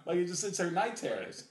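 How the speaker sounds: normal talking voice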